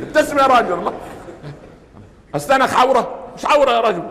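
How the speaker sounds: playful urging. Real, not acted. Urging a listener to smile.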